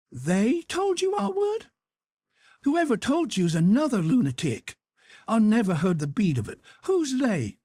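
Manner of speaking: an aunt demanding names; scandalized auntie; incredulous outrage. A narrator's reading. Aunt Sally incredulous and angry.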